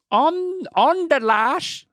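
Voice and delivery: slow, high Irish echo; high Irish lilt, trying it out; mock Irish accent. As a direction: draw it out in a put-on Irish accent. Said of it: "You gotta use an Irish accent".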